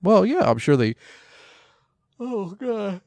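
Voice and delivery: words stretched by a big real yawn; mid-sentence yawn taking over. Real, not acted. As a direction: talk through a yawn, pitch leaping up. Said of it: "I don't know why I'm yawning".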